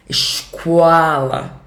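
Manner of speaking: Escola as iscola, Carioca hissing S, slow; Carioca accent. Shown as a demonstration. Teacher imitates the Rio de Janeiro way of saying Escola. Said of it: "Entre o O e o A".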